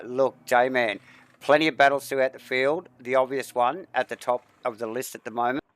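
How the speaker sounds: ordinary delivery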